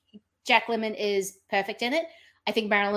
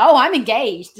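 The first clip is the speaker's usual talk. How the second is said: high, louder gushing delight; giddy quote; 5 semitones higher, 10 dB louder than usual. Retold or imitated. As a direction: burst out with the happy news. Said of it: "And she's like".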